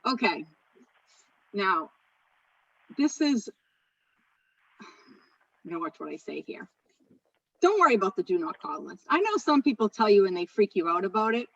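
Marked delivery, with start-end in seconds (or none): 4.8-6.7 much softer, slower, said half to herself; wary aside. Real, not acted. Trainer warns herself before a touchy topic, softly. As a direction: lower the voice like you might get in trouble.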